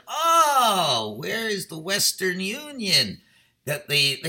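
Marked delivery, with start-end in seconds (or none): 0.1-3.2 sudden realization. Retold or imitated. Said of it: "I said".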